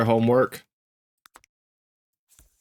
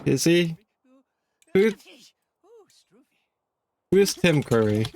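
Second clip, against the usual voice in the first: ordinary talk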